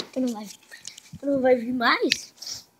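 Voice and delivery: ordinary talk, nothing marked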